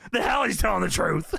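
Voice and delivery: imitated indignant disbelief; mock outrage. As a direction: play a boss refusing to believe it. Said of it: "And Vince is losing it".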